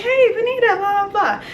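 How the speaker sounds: high-pitched mock hello; gushy greeting imitation. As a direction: mimic a girl calling out hello in a high pitch. Voicing acquaintance's greeting in a story.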